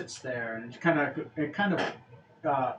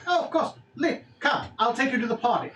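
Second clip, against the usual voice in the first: high, obliging goblin voice; goblin servant; 7 semitones higher, faster than usual. Acted. Goblin offers to guide the guest, high obliging voice. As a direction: agree eagerly in a high goblin pitch. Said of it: "a goblin comes up to you and he's like".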